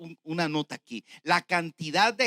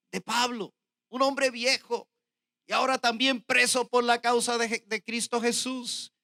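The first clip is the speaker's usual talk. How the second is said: raised, forceful verse reading; preached scripture reading; 7 semitones higher, 6 dB louder than usual. Real, not acted. Preacher reading Paul's plea to Philemon, proclaimed. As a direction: read it out high and measured, like proclaiming. Reads Paul's letter aloud.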